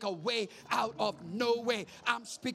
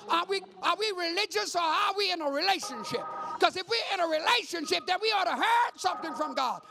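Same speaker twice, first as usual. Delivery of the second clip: loud, high preaching, the last words slowed right down; preaching climax; 10 semitones higher, 10 dB louder than usual. Acted. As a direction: thunder God's promise to the church. Preaching climax voicing God.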